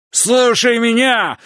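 bellowed right into his face, slowly; furious shout. A narrator's reading. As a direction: roar it with full force. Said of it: "проорал он мне в лицо".

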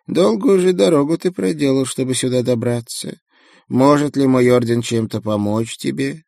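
meek creaky monk speaking; creaky little monk. A narrator's reading.

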